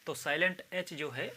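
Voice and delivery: ordinary talk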